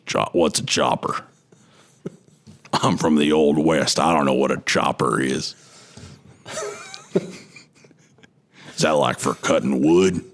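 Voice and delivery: low, puzzled cowboy drawl; low Western gunslinger voice; Arthur Morgan. Acted. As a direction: play a baffled Old West outlaw. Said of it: "I'm gonna do my Arthur Morgan voice again".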